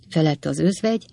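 ordinary delivery, nothing marked

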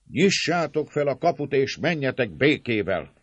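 high, loud call; urging shout. A narrator's reading. Attackers urging servants to leave in peace, shouting. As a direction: shout the demand across the gate. Crowd calls for gate to open.

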